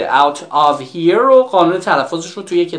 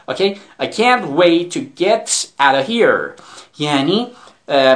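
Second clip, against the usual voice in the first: ordinary delivery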